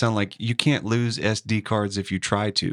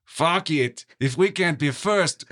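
higher, dismissive mock Russian accent; 4 semitones higher than usual. Refusing a comrade's plea, put-on Russian accent. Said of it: "you said it with a better accent".

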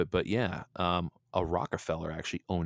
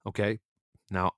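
ordinary talk, nothing marked

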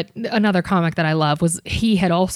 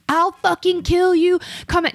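mock scream; 10 semitones higher than usual. Comic mimics drunk girl threatening her, high mock shriek. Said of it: "starts screaming".

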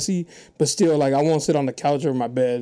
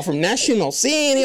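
higher-pitched mock accent on National City; 6 semitones higher than usual. Comic retelling how the other mimicked their hometown, mocking accent. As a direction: echo it back in a teasing put-on accent. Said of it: "Did I say it like that?".

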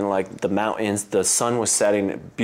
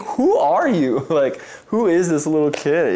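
amazed; 7 semitones higher, 4 dB louder than usual. Retold or imitated. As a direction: marvel at the little kid. Amazed at son's kindness.

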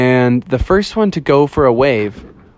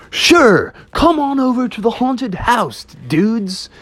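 high, easygoing dude ghost; surfer-dude ghost; 10 semitones higher, slower than usual. Acted. Ghost inviting the bears over, high chummy dude voice. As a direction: play a chummy ghost welcoming his guests.

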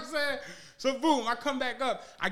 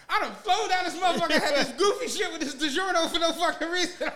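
laughing; 7 semitones higher than usual. Real, not acted. Laughing at the wasted trip.

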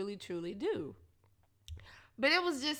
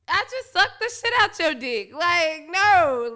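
indignant; 5 semitones higher, 11 dB louder than usual. Retold or imitated. Indignant retold outburst.

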